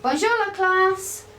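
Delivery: high, slow, sing-song hello to the class; high cheerful classroom bonjour; bright classroom greeting. Acted. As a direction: greet the children like a primary teacher.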